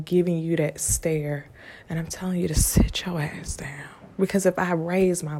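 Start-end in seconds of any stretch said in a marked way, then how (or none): 1.9-3.9 stern unvoiced hush; warning without raising the voice; quiet whisper. Parent giving that stare, whispering. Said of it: "through that quiet whisper".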